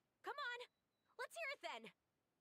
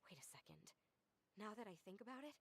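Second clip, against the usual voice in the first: hushed realization; 7 semitones lower, 6 dB quieter, much faster than usual. Acted.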